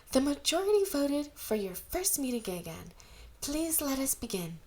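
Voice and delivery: a hint of a formal older sister opening the meeting; eldest sister. Eldest sister addresses the gathering.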